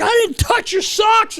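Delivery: very high, drawn-out imitated denial; hammed-up high-pitched protest; indignant mock protest. Acted quarrel between two teammates.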